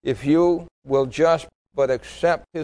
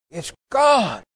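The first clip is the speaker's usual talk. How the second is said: higher-pitched cry of astonished relief; amazed cry; 6 semitones higher than usual. Retold or imitated. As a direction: imitate his dawning amazement, pitch leaping up. Retold moment of sudden deliverance.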